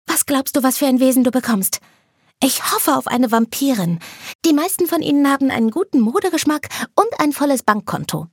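a hint of an excited schoolgirl; Anna, eager chatter. Anna telling her friends what she hopes for, eager.

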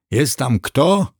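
high slow call into the dark. Acted. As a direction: call down the throat. Calling into the throat.

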